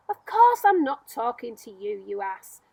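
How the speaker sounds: loud, impatient retort; irritated snap. Acted. Centipede snapping at James, loud and irritated. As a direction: bark it back at him.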